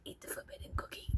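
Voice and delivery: fast, breathy temptation under the breath; tempting whisper. Pretend. Vlogger jokes about eating contacts, fast tempting whisper. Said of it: "they call it the forbidden".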